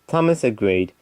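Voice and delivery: ordinary delivery, nothing marked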